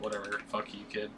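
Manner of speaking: soft, throwaway brush-off; dismissive mutter. Retold or imitated. Barney walking away from the kid, quiet dismissive mutter. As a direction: toss it off quietly, mocking the bully. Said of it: "He was like".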